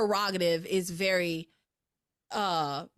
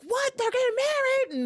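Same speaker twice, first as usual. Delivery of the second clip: high, shocked squeal; mock outrage, voice jumping up high; shocked disbelief; 3 dB louder than usual. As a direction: act floored by the news. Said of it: "He's like".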